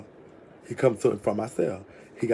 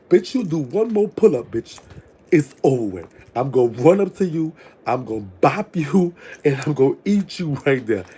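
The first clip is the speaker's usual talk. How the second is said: higher, louder and laughing; frantic lust; 4 semitones higher, 5 dB louder than usual. Retold or imitated. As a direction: build into a giddy, hungry threat. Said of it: "I'm thinking to myself".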